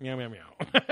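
meowing through laughter; laughing meows. Pretend. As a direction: giggle out the meows.

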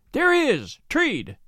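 high-pitched shout of discovery; narrated yell, high at the start; excited yell. A narrator's reading. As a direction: sing out the sighting, pitch leaping up. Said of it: "I yelled".

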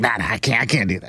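a lightly played old man saying no; imitating the grandpa. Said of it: "He's like".

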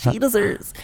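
high, soft affected singer voice; high mannered pop-star imitation. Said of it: "try and do a [name] voice".

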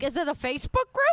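S1 mocks being old and behind on tech, hammed-up clueless oldster. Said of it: "I'm old".

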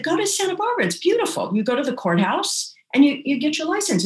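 high-pitched retold recommendation; friend's breezy urging. Friend sending the couple to Santa Barbara, high urging. Friend's advice voiced in story.

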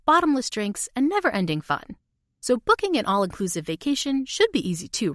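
usual speaking voice

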